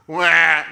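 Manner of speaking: loud short "wah"; mock crying wail. Leader echoing the boy playing crying David, mock wail.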